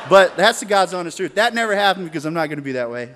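loud laughing protest, fast; laughing insistence. Real, not acted. Swearing to the crowd it's true, loud laughing.

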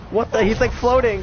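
high, loud astonished outburst; surprised. Real, not acted. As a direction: blurt it out in disbelief. Game character suddenly floating.

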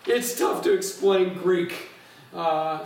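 ordinary delivery

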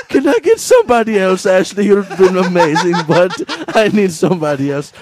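loud, high plea easing down to normal pitch; hammed-up Indian-accented plea, starting at full cry; mock distressed caller. Caller begging for somebody else, loud mock distress.

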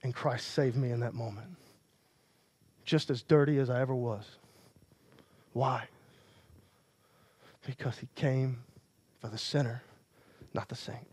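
a quiet confession from the pulpit; hushed preaching. Acted. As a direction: drop it down to draw them in. Quiet, intimate sermon passage.

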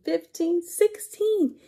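rising to a high finish; building count. Acted. Reader counting cupcakes with children, excited.

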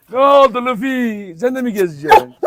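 sweet talk the dog; pet talk. Real, not acted. Cooing at dog Luffy.

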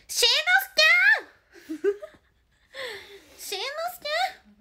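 put-on high, loud mother calling out a child's name; high cartoon-mother call; ミサエ impression. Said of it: "ミサエは無理だな".

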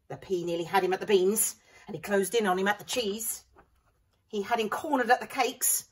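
ordinary delivery

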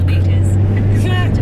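bursting out laughing; loud laugh. Real, not acted. Passenger cracking up at the driver's rant, loud laugh.

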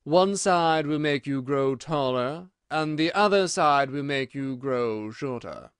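slow, drawn-out caterpillar voice; languid caterpillar. Acted. Caterpillar tells Alice of the two sides, languidly slow. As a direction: stretch each phrase out unhurriedly.

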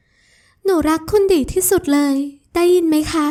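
high, slow, playful voice; teasing sweet girl. Acted. Ramon teases Adisa for acting cute, high and playful. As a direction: tease her fondly, voice lifted.

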